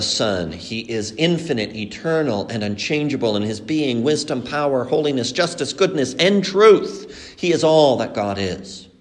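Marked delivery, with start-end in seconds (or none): none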